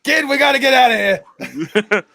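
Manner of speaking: high, loud get-away cry; mock urgent shout. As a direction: hurry the kid out in hammed-up panic. Acted urgent escape line.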